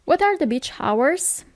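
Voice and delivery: ordinary talk, nothing marked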